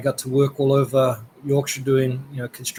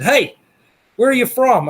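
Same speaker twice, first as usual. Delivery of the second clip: calling up; 10 semitones higher, 4 dB louder than usual. Retold or imitated. Farmer calling up to the window.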